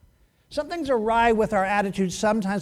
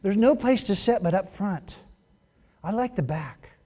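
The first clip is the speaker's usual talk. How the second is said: poor attitude. Acted. Preacher mimics reluctant churchgoer's complaints, mildly grudging. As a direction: lightly act a put-upon complainer. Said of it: "is their poor attitudes".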